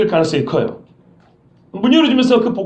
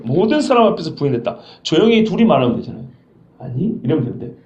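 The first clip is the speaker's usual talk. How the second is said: usual speaking voice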